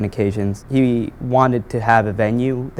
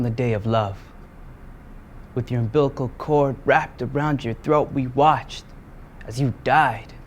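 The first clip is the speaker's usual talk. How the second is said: solemn poetry reading; spoken word poem; 3 semitones higher, 4 dB quieter than usual. Acted. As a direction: open the slam poem with restraint.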